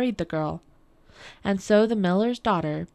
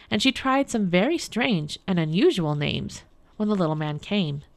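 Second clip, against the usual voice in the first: ordinary delivery, nothing marked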